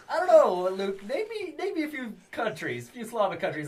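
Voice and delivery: high, hesitant stretched answer; playfully flustered reply; mock high uncertain voice. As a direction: stall the question, unsure. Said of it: "I'm like".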